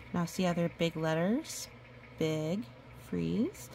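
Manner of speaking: usual speaking voice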